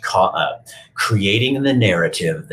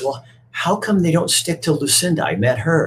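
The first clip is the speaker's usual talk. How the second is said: higher, curious little toy; higher puzzled puppet; 10 semitones higher than usual. Retold or imitated. Punchinella asks Eli about Lucinda, higher. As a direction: ask it like a wondering puppet.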